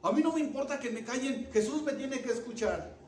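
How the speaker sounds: high, loud, insistent voice; defiant shouting. Acted. Blind man to those silencing him, shouting defiantly. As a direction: push back loudly against being silenced. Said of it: "el más gritaba".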